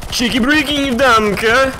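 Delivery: high excited squeal of a gamer catchphrase; gleeful catchphrase. Real, not acted. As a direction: yelp it out, high and loud. Gamer playful catchphrase cry.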